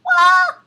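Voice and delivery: piercing excited squeal; high squeal. Real, not acted. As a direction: squeal like a fangirl. Fangirl squeal at intro.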